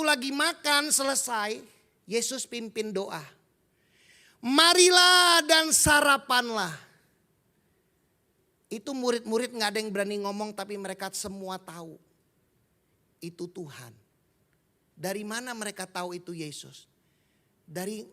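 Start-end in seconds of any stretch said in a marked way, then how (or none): 4.4-6.7 slow, louder, drawn-out invitation to come and eat; Jesus calls the disciples to breakfast, slow and loud; slow inviting call. Acted. 13.2-13.9 the disciples quietly realise it is the Lord; hushed knowing. Acted. Disciples silently know it is him.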